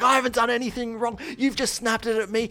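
high-pitched mock yell, not full volume; heated, high-voiced protest; retold screaming. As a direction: imitate yelling in someone's face, held back. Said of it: "I'm screaming in her face".

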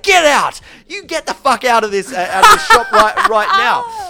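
loud, high retold outburst; yelled order breaking into laughter; snapping back. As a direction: re-enact blowing up at a customer. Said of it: "And then I just snap it".

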